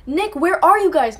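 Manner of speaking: high, loud retold panicked call; panicked plea. Stranded caller begging Nick for help, panicked and loud. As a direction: frantically call out for a missing friend, re-enacted. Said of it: "I'm scared out of my mind".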